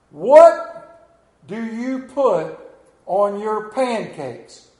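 loud, drawn-out question to the crowd; calling out a question. Real, not acted. Pastor quizzing the congregation, loud and slow. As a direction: stretch it out and call to the pews. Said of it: "I'm going to ask all of you all a question".

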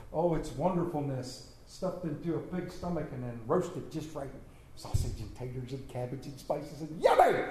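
low, hushed relish, popping up on the last word; savoring description. Retold or imitated. Hillbilly describing hog maw to a young city boy, savoring. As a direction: savor each item softly, then burst out.

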